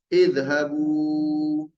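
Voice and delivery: very slow Idhabu, drawn out; careful Arabic model word. Shown as a demonstration. Teacher drills Idhabu slowly for the class. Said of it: "Pergilah kalian semua".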